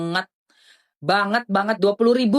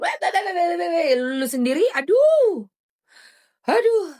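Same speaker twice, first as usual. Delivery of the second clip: high, squealing mocking laugh; 8 semitones higher than usual. Real, not acted. (ketawa mengejek dengan suara tinggi)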